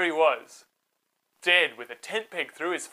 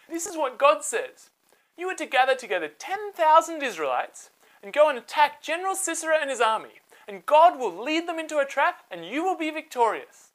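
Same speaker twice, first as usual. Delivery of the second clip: Deborah proclaiming; 9 semitones higher than usual. Acted. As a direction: deliver God's orders boldly. Deborah voiced, God's message.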